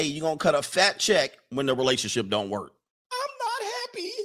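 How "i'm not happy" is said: high, soft mocking wife voice. Husband mocking his wife, much higher and softer. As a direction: squeak it out as the pouting wife.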